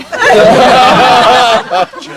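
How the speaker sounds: loud hooting mock laugh; mocking big laugh. Friend hoots with laughter at his trapped buddy. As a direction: burst out laughing at him.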